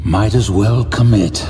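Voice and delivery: grim, resigned resolve; bleakly. Acted. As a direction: commit to it with a heavy heart. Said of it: "he said bleakly".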